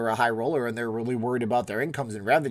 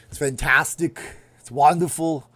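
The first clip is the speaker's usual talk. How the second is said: raised, slurry movie-star impression; drunk Arnold; 4 semitones higher, 6 dB louder than usual. Streamer praising a gadget he loves, as a drunk Arnold. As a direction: put on a tipsy action-hero accent. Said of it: "that sounded like a drunk Arnold".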